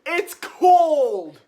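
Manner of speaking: high loud cry; shouting. Pretend. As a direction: yell it out high. Shouted bit.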